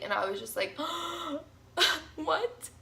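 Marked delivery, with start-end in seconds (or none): 0.8-1.4 high, acted gasp of dismay; sharp intake of breath, re-enacting shock. Patient told the anesthesiologist is busy, freaked-out gasp. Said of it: "So I was freaking out". 2.2-2.7 high, rising, disbelieving question; freaking out. Retold or imitated. Patient told she must wait for pain relief, freaked-out reaction. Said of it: "So I was freaking out".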